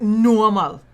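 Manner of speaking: loud mocking shout of normal. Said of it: "normal in his mouth".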